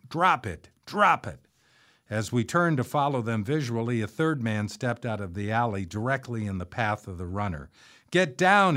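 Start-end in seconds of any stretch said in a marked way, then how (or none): 0.1-1.5 high, restrained narrated shout; sharp repeated command, pitched up. A narrator's reading. Pursuer ordering the runner, moderate shout. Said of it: "a second man shouting". 8.1-8.8 high, loud urgent command; shouting. A narrator's reading. Armed man warning people in the line of fire, shouting. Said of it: "he shouted as he raised his revolver".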